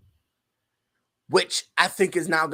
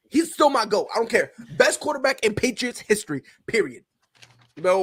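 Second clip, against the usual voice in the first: ordinary talk, nothing marked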